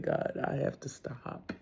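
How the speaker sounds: a hushed, unvoiced plea to God; whispered prayer. Retelling her plea to God to stop cursing, in a whisper. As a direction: whisper the vow under the breath.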